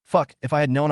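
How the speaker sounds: usual speaking voice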